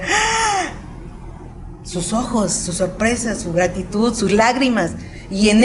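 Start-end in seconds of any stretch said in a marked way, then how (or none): 0.0-0.7 much higher gasp of joy; a bright surprised "ah!" when opening a gift; imitating people opening their gift bags; delighted gasp. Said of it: "cuando ellos abrían sus bolsas y decían".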